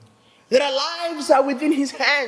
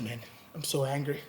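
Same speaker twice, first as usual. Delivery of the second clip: mild retold anger; 6 semitones lower, 5 dB quieter than usual. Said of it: "I was like".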